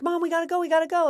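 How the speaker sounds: high, loud urgent kid imitation; pestering kid. Kid hurrying mom out the door, mock urgent pleading.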